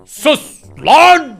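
angry shout. Acted. Uncle snapping at the pitbull pup, angry shout. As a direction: shut him up hard.

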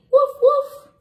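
high, loud playful woof woof; dog bark. Acted. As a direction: bark like a cheerful little dog. Dog barks in picture book.

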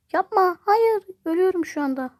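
high, loud cry of protest; real frustrated outcry in a game; anguished yell. Real, not acted. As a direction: shout as the character dies. Dying in game, cries out.

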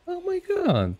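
very high mock-British exclamation; hammed-up British astonishment; put-on British accent. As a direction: squeal it in a fake British accent.

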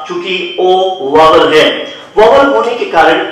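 ordinary delivery

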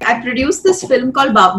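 ordinary talk

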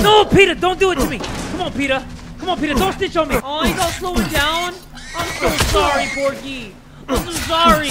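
high, hammed-up begging; mock pleading. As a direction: plead loudly in a pretend panic. Mock pleading with game pig.

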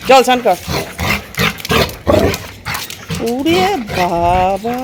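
coaxing a reluctant pet into a bath; pet talk. Real, not acted.

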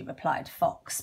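usual speaking voice

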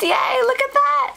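very high, happy squeal of yay; excited squeal. Real, not acted. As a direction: squeal with real joy at the gift. Squeals at her first Glimmer Mist.

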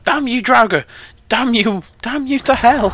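high, loud cry of outrage; hammed-up furious shout; melodramatic cursing. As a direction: curse the foe at full theatrical pitch. Curses an enemy in combat.

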